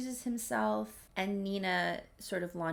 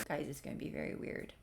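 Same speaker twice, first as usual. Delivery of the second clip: laughing aside; 4 semitones lower, 8 dB quieter, faster than usual. Real, not acted.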